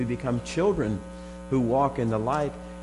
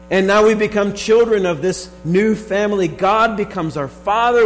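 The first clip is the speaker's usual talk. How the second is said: rising, louder fervent preaching; 6 semitones higher, 5 dB louder than usual. Acted. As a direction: preach it with growing fervour. Preaching builds to full force.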